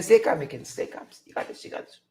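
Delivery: whispering to himself to stay calm; hushed self-reassurance; whispered self-talk. Pretend. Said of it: "I could imagine Hickens saying".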